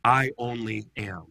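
I, I only am drawn out slowly; slow solemn translation; slow and weighty. Shown as a demonstration. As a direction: lay each word down slowly. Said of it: "the best way to translate ego eimi is".

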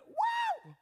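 very high, loud shout of joy; leader's whoop for the crowd to copy; joyful whoop. Shown as a demonstration. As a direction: whoop it out like a cheer. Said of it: "Everybody say that with me".

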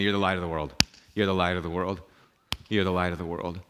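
low, quiet, patient refrain; calm reassurance. Retold or imitated. Jesus repeating himself to the angst-ridden pastor, calm reassurance. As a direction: answer the fretting with soft, low steadiness. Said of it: "Jesus keeps saying to me".

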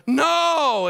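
loud, high cry of no; anguished no. Retold or imitated. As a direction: wail it out in despair. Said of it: "he's like".

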